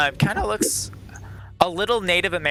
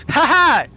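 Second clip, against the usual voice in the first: loud, high cackling laugh; villain cackle; 12 semitones higher, 10 dB louder than usual. Acted. GM voicing Philippa's gloating after her threat, loud cackle.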